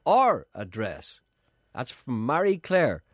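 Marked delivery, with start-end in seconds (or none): 0.1-1.2 slow, raised radio announcement; high, very slow public appeal; reading out a listener message. Real, not acted. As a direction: read it out deliberately, word by word.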